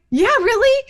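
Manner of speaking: very high rising really; mock-incredulous mom, squeaky high; incredulous echo. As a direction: toss it back, amazed he'd doubt. Mother echoes son, incredulous.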